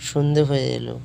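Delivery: usual speaking voice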